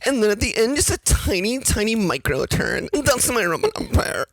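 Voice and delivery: high, lilting mock Valley Girl. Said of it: "Valley Girl talking about farts".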